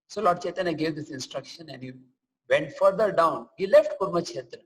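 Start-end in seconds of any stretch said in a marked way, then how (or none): none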